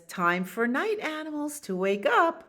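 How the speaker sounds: high sing-out wake-up call; bat character. Acted. Bat rouses the night animals, high cheery call. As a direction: announce it high and rising. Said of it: "And the bat says".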